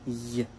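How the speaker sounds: Y softly sounded as in yes; isolated Y sound. Shown as a demonstration. Teacher warning J is not French J, bare Y sound. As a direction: give the Y sound alone, softly. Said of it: "Le J, c'est le Y".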